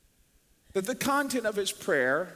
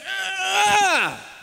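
high loud frustrated yell; frustrated shout; 12 semitones higher, 6 dB louder than usual. Retold or imitated. David overwhelmed by his advisers, shouting in frustration. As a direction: let out a burst of frustration. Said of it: "David's going".

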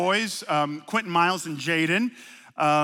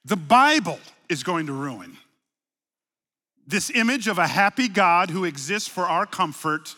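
ordinary talk

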